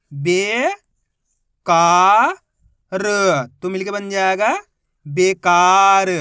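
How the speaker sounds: "बे", "का", "र" अलग अलग, फिर "बेकार" धीमा, ज़ोर से; रुक रुक कर अक्षर, अंत में पूरा शब्द खींचो; अक्षर अक्षर पढ़ना. Shown as a demonstration. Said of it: "मेरे तरीके पे गौर करना है". (शिक्षक का दिखाया तरीका, धीमा ज़ोरदार "बेकार")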